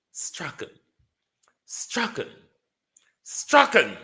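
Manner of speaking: "Strachan" dreimal als Strakan, von gehaucht bis laut; "Strachan" als Strakan wiederholen, jedes Mal lauter; repeating Strachan. Shown as a demonstration. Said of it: "quite a popular British name".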